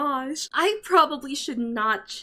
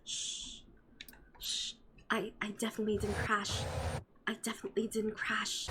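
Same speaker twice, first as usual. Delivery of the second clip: hushed whisper, shushing herself; guilty whisper; 3 semitones lower, 10 dB quieter than usual. Real, not acted. Whispering to the viewers after a crash she denies. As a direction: whisper it like you're hiding a crash.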